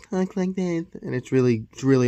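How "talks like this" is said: lightly imitated soft voice; a hint of a big man's soft voice; Mike Tyson. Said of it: "Mike Tyson is literally".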